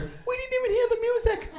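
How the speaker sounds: high-pitched mock disbelief; mock shocked cry. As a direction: imitate stunned victims wailing to a camera. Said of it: "They're screaming in shock".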